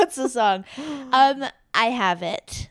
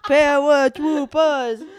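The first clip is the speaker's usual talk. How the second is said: drawn-out paratroopers; 4 semitones higher, 4 dB louder, much slower than usual. Pretend. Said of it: "bring back paratroopers".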